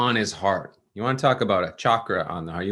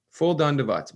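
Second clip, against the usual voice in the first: normal talking voice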